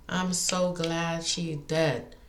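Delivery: drawn out, each word weighed; slow it right down to land it; slow, deliberate repeat; slow emphatic repeat. Real, not acted. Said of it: "repeats line slowly".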